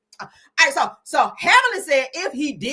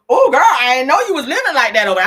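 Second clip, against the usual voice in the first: normal talking voice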